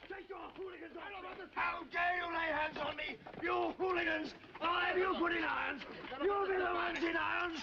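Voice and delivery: outraged, shouted protest; indignant shouting. Acted. Man protesting as he is seized by hooligans, indignant shouting.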